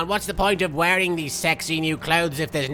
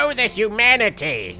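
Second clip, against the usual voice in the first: louder, higher mock anguish; over-the-top lament at the disaster; hammed-up wail; 7 semitones higher, 4 dB louder than usual. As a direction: wail it like a tragic newsreel. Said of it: "Get it, Bakura?".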